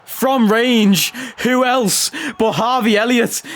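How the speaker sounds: goal call. Real, not acted. Hyping his star's long-range goal, excited commentator call.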